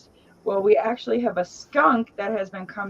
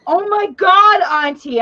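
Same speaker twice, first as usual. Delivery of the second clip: much higher and louder shocked cry; shocked little girl; 12 semitones higher, 10 dB louder than usual. Retold or imitated. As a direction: gasp at what you just saw in your hand. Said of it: "she's like".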